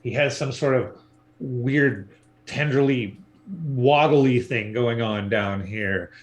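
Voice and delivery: usual speaking voice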